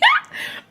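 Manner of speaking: sudden squealing laugh, way up high; high burst of laughter. Real, not acted. Laughing at herself while telling the story. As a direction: let a high giggle burst out.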